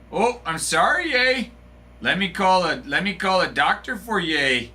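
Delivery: hammed-up apologetic Canadian; mock Canadian accent. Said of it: "how was my accent".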